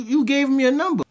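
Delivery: usual speaking voice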